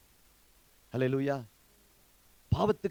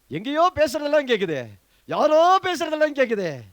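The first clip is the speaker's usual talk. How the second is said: mock amazement; 9 semitones higher, 8 dB louder than usual. Real, not acted. Teases congregation about overhearing talk.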